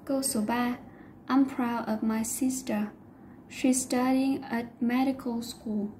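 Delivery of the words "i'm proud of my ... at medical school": slow model reading. Shown as a demonstration. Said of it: "Medical trọng âm rơi âm đầu tiên".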